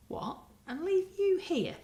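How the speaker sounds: high, shocked outburst; can't believe what you hear; shocked exclaim. A narrator's reading. Marcia to Spider, shocked and high. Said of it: "exclaimed Marcia".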